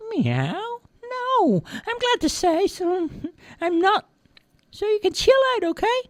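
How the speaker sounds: very high cartoon cat voice; easygoing cat. A narrator's reading. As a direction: reassure as a laid-back little cat.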